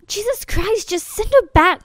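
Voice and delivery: high-pitched, heated outburst; exasperated demand. Acted. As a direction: snap it out with voice jumping up. Audition, exasperated demand to send her away.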